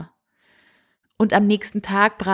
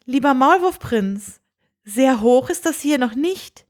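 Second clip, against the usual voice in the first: higher, gentle princess questioning the mole prince; 4 semitones higher than usual. Acted. Princess gently doubting the mole prince, higher voice. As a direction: voice the young princess, lightly raised.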